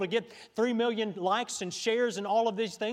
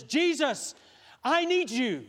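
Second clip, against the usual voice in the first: raised, pleading cry for help; urgent plea; 4 semitones higher, 3 dB louder, slower than usual. Acted. Mary calls to Jesus at the wedding, urgent plea. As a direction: call out urgently in need.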